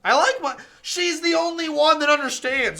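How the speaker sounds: loud, high ranting; heated yelling. Real, not acted. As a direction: yell it out in mock rage. Streamer raging in game.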